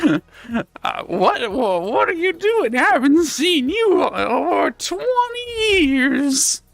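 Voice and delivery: put-on high, wavering old crone voice, near crying; a creaky hag straining her voice up high; croaky old witch. Witch greets long-absent Charles, old crone voice. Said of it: "you sound a lot worse".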